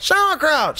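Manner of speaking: high, small offering; sheepish one-word German. Retold or imitated. Teacher's only German word.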